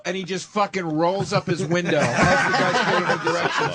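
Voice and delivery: ordinary talk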